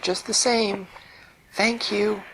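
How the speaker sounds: high squeaky heartfelt thanks; grateful pufferfish. Acted. Pufferfish insisting on thanking the dream fairy. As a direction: thank her in the little fish voice.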